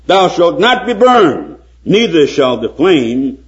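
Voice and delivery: high, loud preaching of scripture; fervent pulpit reading. Acted. Reads Isaiah in preaching voice.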